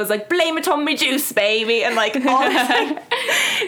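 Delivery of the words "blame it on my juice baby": high-pitched mock British accent; exaggerated UK accent impression; British regional accent. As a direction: imitate a Brit saying it, hammed up. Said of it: "different people from different areas of".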